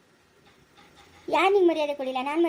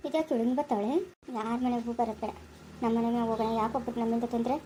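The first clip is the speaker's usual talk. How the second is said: hurt muttering; 7 semitones lower, 7 dB quieter than usual. Acted. Hurt sister grumbling to herself.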